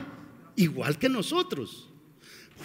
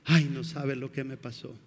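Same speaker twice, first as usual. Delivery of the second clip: pitiful whining; 7 semitones lower, 5 dB quieter than usual. Retold or imitated. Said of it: "Vamos dando lástima".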